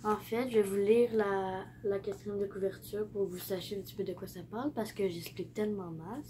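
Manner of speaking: usual speaking voice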